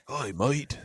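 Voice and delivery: playful Australian greeting; Australian accent. Pretend. Joking with co-hosts on request, Australian accent. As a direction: put on a light Australian accent. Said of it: "do it in an Australian accent".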